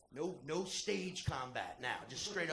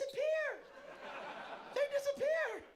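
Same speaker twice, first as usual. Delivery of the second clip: squeaky disappear. Retold or imitated. Describing legs giving out.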